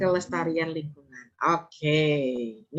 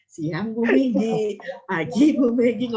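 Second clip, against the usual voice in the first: slow, drawn-out polite hello, pitched up; unhurried greeting; 5 semitones higher, 7 dB louder, slower than usual. Shown as a demonstration. Lecturer showing students how to greet without shrieking, unhurried. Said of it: "Pelan-pelan aja ngomongnya".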